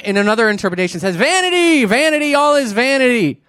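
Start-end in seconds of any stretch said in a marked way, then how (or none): 1.2-3.4 put-on grumpy old man. Preacher acting out how the book starts off, grumpy shouting. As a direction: holler it like a cranky old man. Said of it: "he sounds like a grumpy old man".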